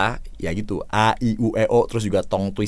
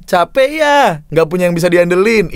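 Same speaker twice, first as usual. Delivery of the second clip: ad read demo; 6 semitones higher, 5 dB louder than usual. Shown as a demonstration. Coach repeating the ad line to praise its intonation, lilting read. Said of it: "Ini aja udah asik nih intonasinya".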